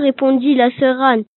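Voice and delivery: usual speaking voice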